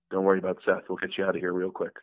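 low, soft, quick brush-off; imitated coworker. Voice actor fending off an eager fan, low and soft. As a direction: murmur it low and reassuringly. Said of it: "he goes".

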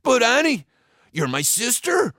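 high-pitched pleading protest; hammed-up wounded little brother; blubbering whine. A narrator's reading. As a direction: whine at your big sister. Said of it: "Joe blubbered and he whined".

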